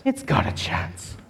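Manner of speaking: hushed whisper, slow; soft whispered echo of hope. Acted. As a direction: breathe the words in awe. Lion repeats plan in awe.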